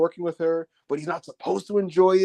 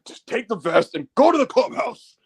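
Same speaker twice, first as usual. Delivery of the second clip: stern Priest; 5 semitones higher, 4 dB louder than usual. Retold or imitated. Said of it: "Priest is like".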